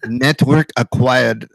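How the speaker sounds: put-on slow, low Russian-accented prompt. Host imitates headphones' voice prompt, slow Russian accent. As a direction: imitate a Natasha-style Russian accent, drawn out. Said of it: "they talk in a Russian accent".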